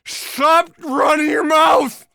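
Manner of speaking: high, slow, loud scolding shout; beaten husband scolding. Retold or imitated. Beaten husband yelling at his wife, high slow shout. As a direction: yell it at her slowly, bloodied. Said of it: "You go".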